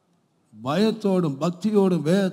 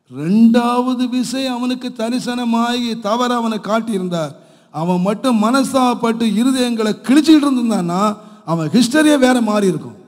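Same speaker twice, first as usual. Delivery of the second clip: loud, higher-pitched sermon delivery; impassioned preaching; 6 semitones higher, 7 dB louder than usual. Acted. Preacher urging that Solomon could have repented, impassioned. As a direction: preach it out with raised, driving force.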